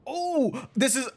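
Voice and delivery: high, delighted burst of recognition; excited recognition. Real, not acted. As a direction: exclaim it high as it clicks. Recognizes the actor's famous role.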